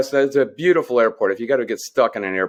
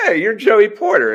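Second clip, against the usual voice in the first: excited recognition; 10 semitones higher, 5 dB louder than usual. Retold or imitated. Excited greeting of a player.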